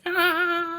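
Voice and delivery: a sudden high shriek; startled shriek. Retold or imitated. Rosalita's startled yelp at the boy, a high shriek. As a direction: yelp in mock surprise, very high. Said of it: "Rosalita's like".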